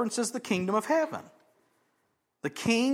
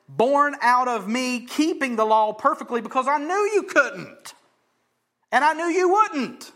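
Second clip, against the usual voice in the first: loud preaching climbing to a high shout; impassioned proclamation; 4 semitones higher, 5 dB louder than usual. Acted. Preacher as Jesus telling hearers he knew, impassioned. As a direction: build in force to a ringing peak.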